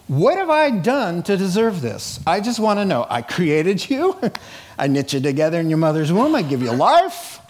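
usual speaking voice

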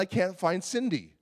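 ordinary delivery

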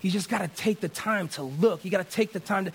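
ordinary delivery

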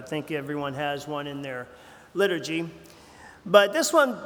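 usual speaking voice

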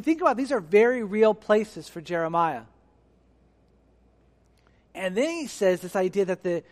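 usual speaking voice

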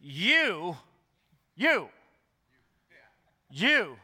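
"You" drawn out and punched, standing alone; emphatic YOU. Shown as a demonstration. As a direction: hammer each "you", with a pause after it. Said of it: "He is emphasizing it".

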